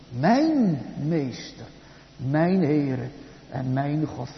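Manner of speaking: slow, each "mijn" weighted; stress on mijn. Shown as a demonstration. Preacher showing Luther's point, slowly stressing each "mijn". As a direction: hit every "mijn" in "mijn meester, mijn Heere en mijn God". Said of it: "Het gaat altijd om de bezittelijke voornaamwoorden".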